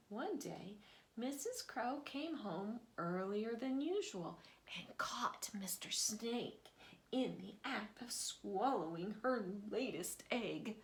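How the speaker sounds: usual speaking voice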